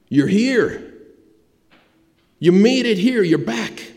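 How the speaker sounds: high, excited cry of welcome; overjoyed father. Acted. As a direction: greet a long-lost son, voice lifting high. Father greets his returning son.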